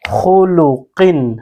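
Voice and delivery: slow demo of خلقن with a thick, heavy خ, the mouth full; heavy full-mouth letter. Shown as a demonstration. Quran teacher showing students the heavy خ, slow and full-mouthed. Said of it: "خا کو ہم نے ابھی موٹا پڑھنا ہے".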